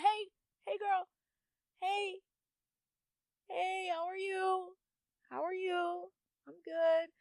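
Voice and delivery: high, sing-song sweet greeting; gentle self check-in. Acted. Talking kindly to herself, gentle sing-song check-in. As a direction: coo a drawn-out, friendly hello.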